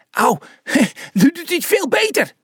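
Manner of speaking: very high squeaky exclamation; high-pitched, delighted outburst; excited little spectator. A narrator's reading. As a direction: squeal it in a tiny character voice. Small character cries out watching fight.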